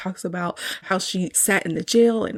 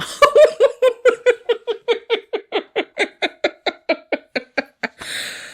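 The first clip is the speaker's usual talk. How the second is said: long, much higher laugh that keeps rolling; long real laugh; 4 dB louder than usual. Real, not acted. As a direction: laugh hard and long at the joke. After quoting Sophia "Hell no.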